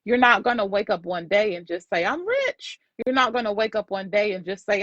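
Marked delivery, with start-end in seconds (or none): 2.0-2.8 high-pitched imagined exclamation; mock elation. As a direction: play a sudden, too-easy triumph. Acting out imagined sudden wish come true.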